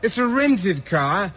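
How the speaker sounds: normal talking voice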